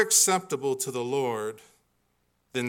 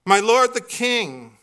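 loud, raised call, drawn out; 7 semitones higher, 8 dB louder, slower than usual. A narrator's reading. David calls out to Saul from the cave, calling out. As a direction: call out slowly and loudly to someone distant. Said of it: "called out to Saul".

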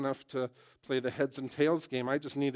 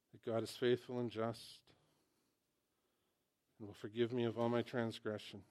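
prayer lead; 2 semitones lower, 7 dB quieter than usual. Acted. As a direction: lead them gently in prayer. Leads sinner's prayer.

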